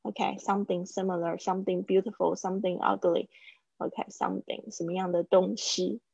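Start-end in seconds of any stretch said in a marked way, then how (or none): none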